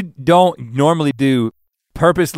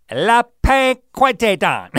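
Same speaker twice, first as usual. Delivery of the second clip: slow, high mock French, laughing; fake French accent; 11 semitones higher, 5 dB louder, much slower than usual. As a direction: stretch it out like bad French. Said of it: "you did not say it in a French accent".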